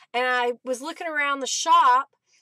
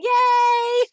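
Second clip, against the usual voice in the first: happy cheer; 11 semitones higher, 9 dB louder than usual. Real, not acted. Chat finally works, cheering.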